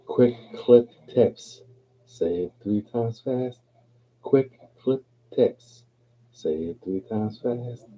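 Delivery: each word of the tongue twister set apart; measured tongue-twister recital; slow and deliberate. Shown as a demonstration. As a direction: lay it out word by word with gaps.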